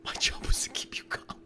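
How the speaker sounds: breathy whispered line; whispering a reassuring quote. Acted. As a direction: drop to a secret whisper.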